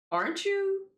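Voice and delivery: high-pitched questioning squeak; mock astonished question, very high; surprised recognition. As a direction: act out a startled realisation, voice shooting up. Acted surprised recognition of other character.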